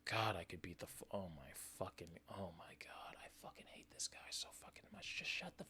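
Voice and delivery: furious whispering under the breath; seething whisper. Real, not acted. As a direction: hiss the rage out quietly, barely voiced. Seething at the clip.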